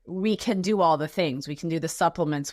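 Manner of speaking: ordinary talk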